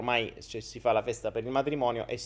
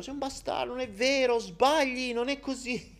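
mocking accuser; 10 semitones higher, 4 dB quieter than usual. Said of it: "prima dicevo".

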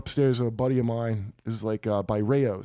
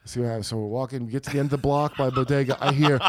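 ordinary talk

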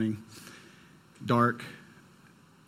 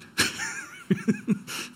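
high nervous laugh at the memory; a high nervous giggle; 3 dB quieter than usual. Real, not acted. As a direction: laugh off the fear. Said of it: "And I'm terrified".

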